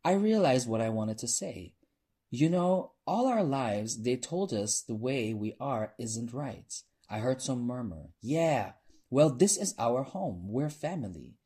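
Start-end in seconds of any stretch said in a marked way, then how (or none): 2.3-6.8 raised, earnest public speech; addressing a crowd. A narrator's reading. Performer to the club audience, rallying speech. 8.2-11.4 higher, emphatic public speech; rallying a crowd. A narrator's reading. Performer to a murmuring club crowd, rallying.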